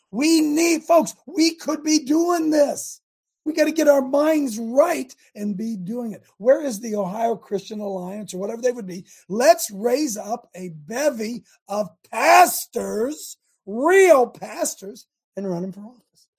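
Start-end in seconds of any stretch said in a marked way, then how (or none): 0.1-5.1 higher and louder, slowing right down; fired-up rally call. Real, not acted. 9.3-15.1 slow, louder, leaning hard on real; rallying emphasis. Real, not acted.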